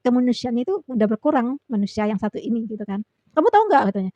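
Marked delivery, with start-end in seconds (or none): 3.3-3.8 high, loud mock rebuke; imitated scolding. Leader lectures worker about taking leave, imitated scolding. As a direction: mimic a boss starting another lecture. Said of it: "aku diomel-omelin".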